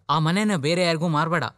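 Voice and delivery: insist, almost begging him not to sell; urgent plea. Acted. Elder brother urging him to keep the family house, loud and pleading.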